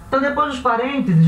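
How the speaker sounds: normal talking voice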